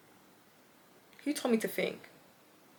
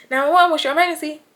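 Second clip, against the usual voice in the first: corrected operator voice; 5 semitones higher, 5 dB louder, faster than usual. Retold or imitated. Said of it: "It's nine one one, guys, sorry".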